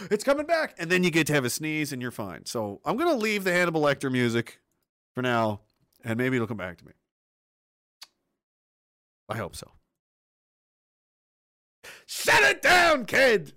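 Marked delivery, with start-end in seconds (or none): 0.1-0.7 relieved exclamation. Retold or imitated. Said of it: "you go". 12.0-13.5 slow, high, loud goofy bark; stupid shouting voice. Acted. Silly voice shouting at himself.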